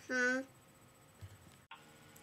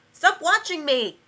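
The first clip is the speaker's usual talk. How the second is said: shouting; 5 semitones higher, 11 dB louder than usual. Acted. Yells at camera.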